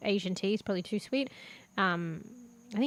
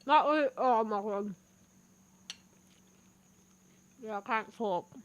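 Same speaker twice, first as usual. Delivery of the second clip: talking through a mouthful of food; muffled, with food in the mouth; mouth full. Real, not acted. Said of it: "still the muffled voice".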